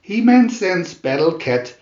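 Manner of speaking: ordinary delivery, nothing marked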